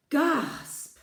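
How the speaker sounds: sharp high gasp. Acted. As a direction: gasp in surprise. Reader gasps at the book.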